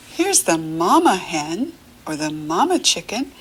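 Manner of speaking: normal talking voice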